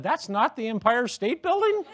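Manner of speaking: high, laughing fake confusion; feigned bewilderment with a laugh; mock surprise. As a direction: play the clueless tourist, pitched high. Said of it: "I pointed at the Alamo and said".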